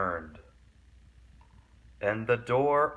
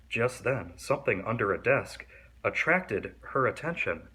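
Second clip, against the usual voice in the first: usual speaking voice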